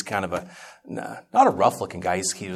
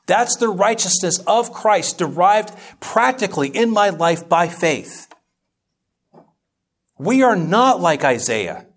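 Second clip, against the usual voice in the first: much higher, louder impassioned sermon; full-force preaching; 10 semitones higher, 6 dB louder than usual. Acted. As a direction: preach it out with building fire.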